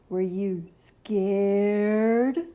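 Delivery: very slow, drawn-out rising question; teasing slow monster. Acted. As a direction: stretch the words to tease. Gabe teases kid.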